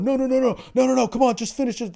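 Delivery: mock desperate whine. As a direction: whimper like a guy left hanging. Mocking a guy the hooker left.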